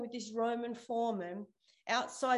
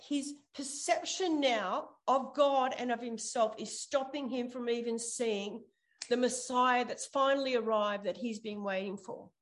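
usual speaking voice

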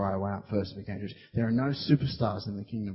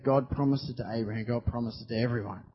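usual speaking voice

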